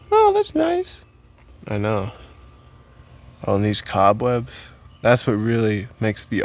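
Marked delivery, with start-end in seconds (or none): 0.1-1.0 put-on very high falsetto girl; high girl voice, polite brush-off; girl's voice. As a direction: squeak it out like an unimpressed girl. Girl's reply in acted pickup.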